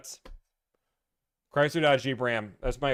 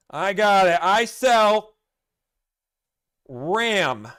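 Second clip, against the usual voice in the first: put-on loud, high shouty old man; old grandpa voice; 7 semitones higher, 9 dB louder, much slower than usual. Grandpa yelling at his first smartphone, loud and high. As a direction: bellow it like a confused grandpa. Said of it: "When my grandpa had used his first smartphone, he's like".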